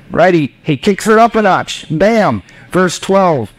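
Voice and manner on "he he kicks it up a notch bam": ordinary talk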